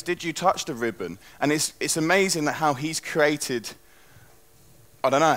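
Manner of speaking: normal talking voice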